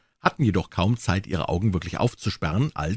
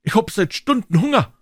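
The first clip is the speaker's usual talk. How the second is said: put-on whining boys; 13 semitones higher, 4 dB louder than usual. Boys howling.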